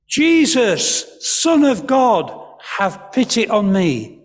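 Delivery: loud, high-pitched crying out; loud as you can. Shown as a demonstration. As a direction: call out over a noisy crowd. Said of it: "say it as loud as you can".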